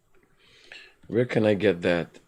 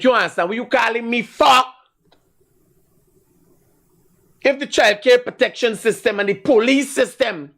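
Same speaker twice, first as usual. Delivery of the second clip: ranting shout; 11 semitones higher, 4 dB louder than usual. Real, not acted. Ranting at callers.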